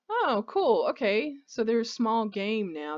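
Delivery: real thrill at a new discovery; excited. Real, not acted. Surprised by new rabbits in game.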